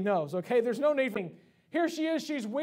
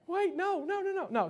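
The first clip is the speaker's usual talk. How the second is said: high, quick string of protest; mock alarmed child; 8 semitones higher than usual. Son told his birthday is canceled, mock-panicked protest. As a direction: imitate a kid panicking at bad news.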